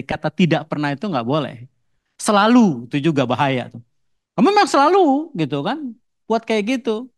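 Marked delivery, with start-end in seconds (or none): 2.2-2.9 a sharp, louder, higher "Selalu"; accusing. Acted. Spouse hurling "always" in a quarrel, accusing. 4.3-7.1 much higher, whiny accusing spouse voice; nagging accusation. Acted. Spouse complaining the partner always acts that way, high and whiny.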